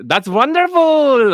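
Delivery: put-on high, drawn-out Indian-accent caricature; mock Indian accent. Joking reply to the woman, exaggerated Indian accent. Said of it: "it's not trying to be racist".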